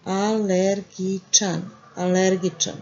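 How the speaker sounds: slow, carefully spelled out. Shown as a demonstration. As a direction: say it slowly for learners. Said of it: "Allergic to Monday".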